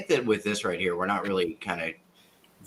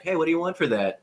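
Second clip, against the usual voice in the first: higher-pitched quoted question; retold own question; 8 semitones higher than usual. Retelling asking the boss his price, lightly acted. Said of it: "I was like".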